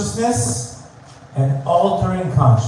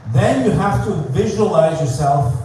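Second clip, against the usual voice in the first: normal talking voice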